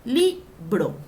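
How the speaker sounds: Libro said loudly as LI... BRO; syllables separated. Shown as a demonstration. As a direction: punch LI in Libro, pause before BRO. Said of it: "¿Dónde lleva?".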